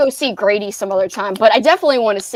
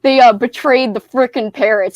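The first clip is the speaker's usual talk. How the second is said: usual speaking voice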